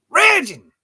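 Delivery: big high comic yell; mock raging shout. Shown as a demonstration. Vlogger hamming up her Duolingo rage, shouting. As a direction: shout it like a tantrum. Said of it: "I used to be raging".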